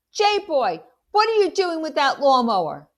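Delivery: loud, high angry call while running; yelling. Acted. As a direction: shout the question as you chase. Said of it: "I'm yelling".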